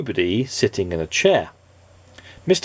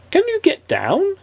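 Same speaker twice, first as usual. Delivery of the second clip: much higher bright character voice; cheery Mister Happy. Acted. Mister Happy calling up to the tree, high cheery voice. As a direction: pitch it up like a jolly little man.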